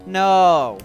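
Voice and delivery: loud horrified no; anguished shout. Acted. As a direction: cry out in dismay. Hero cries out in horror.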